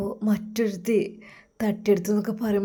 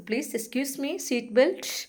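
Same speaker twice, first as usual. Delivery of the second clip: air hostess voice; 5 semitones higher than usual. Acted. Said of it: "അതാ air hostess".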